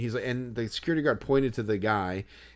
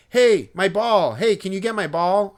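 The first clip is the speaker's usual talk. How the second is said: put-on louder, higher kid calling out; demanding kid; 8 semitones higher, 8 dB louder than usual. Kid calling to a security guard for his ball, demanding shout. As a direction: imitate a kid hollering for attention. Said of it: "the kid just goes like".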